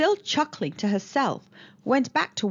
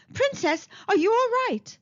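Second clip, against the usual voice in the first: higher, louder worried townsman; a man calls out in alarm to the princess; worried cry; 11 semitones higher, 3 dB louder than usual. A narrator's reading. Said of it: "cried one of the men".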